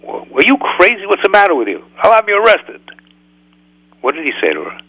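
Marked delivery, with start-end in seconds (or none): 0.0-2.8 higher, indignant outburst; a rabbi imagining an angry reply to the woman, a higher indignant outburst; mock outrage. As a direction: snap at her as if offended, much higher. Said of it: "He could have said".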